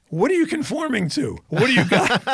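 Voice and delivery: high-pitched parody of a challenge; mock movie line. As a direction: toss it out high and punchy, breaking into laughter.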